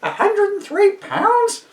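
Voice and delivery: put-on very high, slow shocked old woman; crying old lady. A narrator's reading. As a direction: shriek it like a horrified pensioner. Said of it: "cried Missus Nees".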